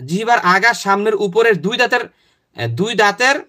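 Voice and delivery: ordinary talk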